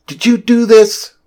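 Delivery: angry demand. A narrator's reading. Rachel confronts the President on the phone, angry demand. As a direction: throw it at her like an accusation. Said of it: "Rachel demanded of her".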